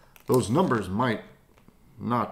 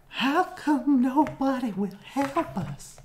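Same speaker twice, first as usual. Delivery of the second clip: put-on high kid voice; 14 semitones higher than usual. Kids ask for help.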